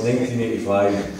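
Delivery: ordinary talk, nothing marked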